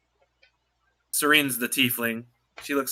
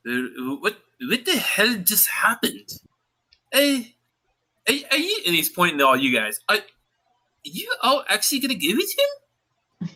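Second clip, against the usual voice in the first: baffled dwarf; 6 semitones higher than usual. Acted. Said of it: "one of them goes".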